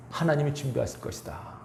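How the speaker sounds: 가상으로 솔직히 말하는 아브라함; 숨긴 속뜻을 가볍게 드러내듯; 가정된 속마음. Pretend. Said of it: "이렇게 이래야 되는데". (아브라함의 가상 속마음을 담담하게)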